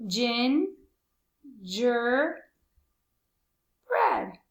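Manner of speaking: GINGERBREAD voiced very slowly, long gaps between syllables. Shown as a demonstration. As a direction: stretch out "gin... ger... bread". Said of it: "That's right, it's three".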